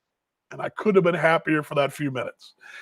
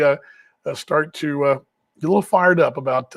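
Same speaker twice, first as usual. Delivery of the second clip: ordinary delivery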